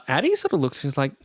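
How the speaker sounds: usual speaking voice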